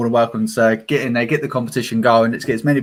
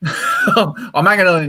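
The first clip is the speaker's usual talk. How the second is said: laughing; 5 semitones higher, 3 dB louder, slower than usual. Real, not acted. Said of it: "I am hanging on in there".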